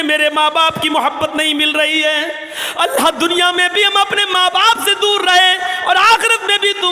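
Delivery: fiery storytelling sermon to the congregation; preaching at full force. Acted. Preacher tells the Judgement story.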